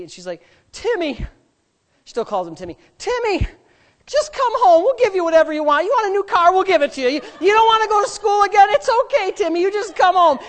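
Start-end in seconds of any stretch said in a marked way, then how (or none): 0.7-1.1 high-pitched pleading mom voice; sweet mom. Retold or imitated. Friend's mom calling her runaway son, sweetly. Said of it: "she's just so sweet". 3.0-10.4 high, loud pleading mother voice; sweet mom. Retold or imitated. Mom begging her runaway son to come home, pleading sweetly. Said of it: "she's just so sweet".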